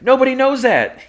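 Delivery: very high, loud mock yell; acted outburst. Lois contradicting Jimmy about the relationship, high mock shout. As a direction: burst out in an exaggerated high-pitched shout.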